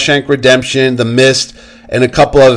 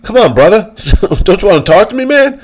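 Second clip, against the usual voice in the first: high, pleading buddy voice with a laugh; mock Jon Bernthal coaxing; Bernthal imitation; 7 semitones higher than usual. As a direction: coax like a pushy friendly actor. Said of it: "And Bernthal goes".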